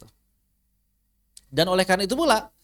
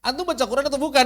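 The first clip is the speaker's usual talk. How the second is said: higher, louder, fast scolding; stern rebuke; 9 semitones higher, 5 dB louder, faster than usual. Retold or imitated. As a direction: scold the student sharply. (teguran keras Syekh kepada peserta)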